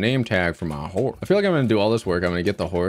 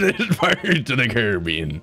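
lightly hammed-up pirate accent, higher pitched; 4 semitones higher than usual. Stranded at sea, playing along in pirate accent. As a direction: put some pirate twist on it. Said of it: "twist it up a little bit into a pirate accent".

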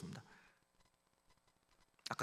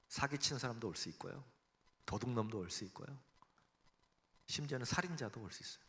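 normal talking voice

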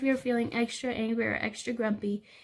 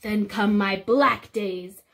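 louder, slow mock growl; mad and loud; 3 semitones higher, 9 dB louder, slower than usual. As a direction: growl it like an angry dinosaur. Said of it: "mad and loud".

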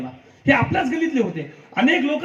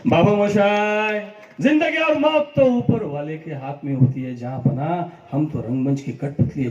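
Rajesh Khanna film line; 4 semitones lower than usual. Retold or imitated. Quoting Rajesh Khanna's Anand line to the audience. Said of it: "Rajesh खन्नाजींचा dialogue".

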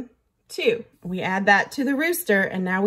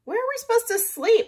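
much higher, fretful question; worried frogs; 13 semitones higher, faster than usual. A narrator's reading. Frogs ask rooster where to sleep, much higher fretful voice. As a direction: ask as anxious little frogs. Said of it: "asked the frogs".

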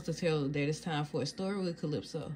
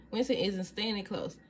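plain reading voice

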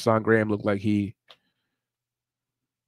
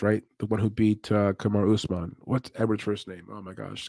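ordinary delivery

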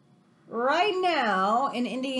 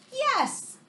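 high bright exclaimed yes; thrilled little cheer of yes; excited yes; 12 semitones higher than usual. Acted. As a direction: burst out with the answer like a reveal. Answers book's question excitedly.

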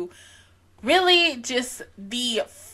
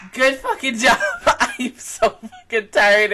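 laughing; 3 semitones higher, 5 dB louder, slower than usual. Real, not acted. Celebrating finishing, laughing.

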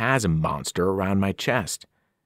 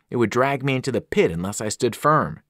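ordinary delivery

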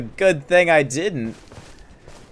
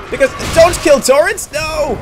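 alarmed protest; 14 semitones higher, 8 dB louder than usual. Real, not acted. Horse is being killed in game.

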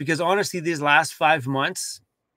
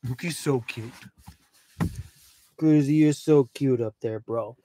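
dog cooing; 3 semitones lower than usual. Real, not acted.

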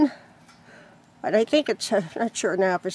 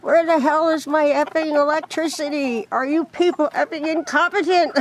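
retold frustrated yell; 5 semitones higher than usual. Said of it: "I yelled into the air with frustration".

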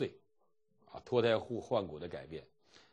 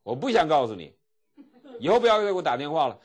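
louder, higher acted outburst; mock angry refusal; 7 semitones higher, 11 dB louder than usual. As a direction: cut the caller off in an imitated huff. Acting an angry refusal on phone. (模仿生气地大声拒绝)

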